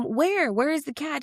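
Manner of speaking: a hint of an eager partner hunting around; mild high-pitched calling for the cat; boyfriend searching. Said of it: "the boyfriend will come".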